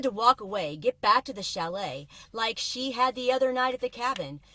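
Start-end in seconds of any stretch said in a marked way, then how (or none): none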